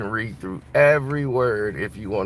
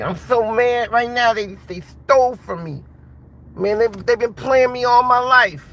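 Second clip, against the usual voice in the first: much higher, louder put-on boxer voice, seething; Mike Tyson; 12 semitones higher, 5 dB louder than usual. Tyson fuming at the people who robbed him, high-pitched and loud. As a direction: play the champ fuming at being robbed, pitched way up. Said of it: "Mike gonna be".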